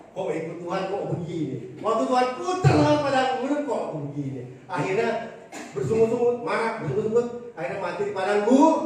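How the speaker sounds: loud, driving sermon cadence; forceful preaching. Real, not acted. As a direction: hammer each line home to the congregation.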